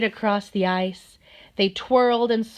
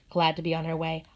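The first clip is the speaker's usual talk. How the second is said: plain reading voice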